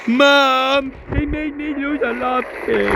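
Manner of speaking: high put-on kid's complaint; mock childish whining. Child tells mom about lost loot, mock whiny. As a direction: whine it like a tattling child.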